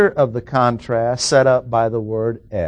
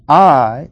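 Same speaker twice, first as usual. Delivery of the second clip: "I" louder and drawn out, set apart; stress on I; 3 semitones higher, 6 dB louder than usual. Shown as a demonstration. Teacher marking the verse's contrast for the class, stressing "I". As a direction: hit "I" hard as the emphatic pronoun. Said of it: "I will stress the four emphatic pronouns".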